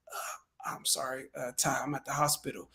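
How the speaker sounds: very soft, low, weary phone voice; subdued hospital caller. Shown as a demonstration. Coach playing a seller answering from hospital, subdued. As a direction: answer weakly, barely voiced. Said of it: "answered the phone and was just like".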